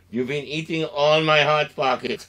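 ordinary delivery

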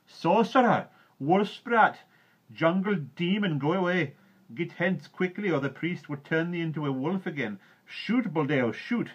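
high, loud villagers' yells; angry crowd shouting. A narrator's reading. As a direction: drive the intruder off with shouts.

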